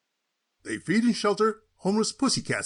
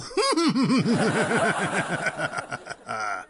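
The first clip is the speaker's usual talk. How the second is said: smug laugh. Acted. Gloating after the fat joke.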